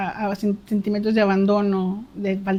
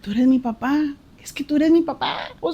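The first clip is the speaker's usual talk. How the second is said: moved prayer; 5 semitones higher than usual. Retold or imitated. Voicing her prayer to God.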